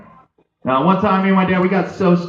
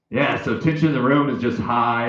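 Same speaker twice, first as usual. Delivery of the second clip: usual speaking voice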